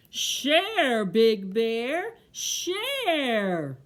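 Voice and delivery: loud, drawn-out scolding call; old tree yelling. Acted. Old tree scolds Bear into sharing, yelling. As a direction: lecture loudly as the old tree. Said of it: "The old tree yelled".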